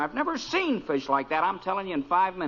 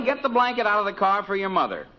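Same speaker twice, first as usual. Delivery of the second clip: normal talking voice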